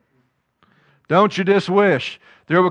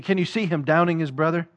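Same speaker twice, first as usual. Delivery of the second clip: ordinary talk, nothing marked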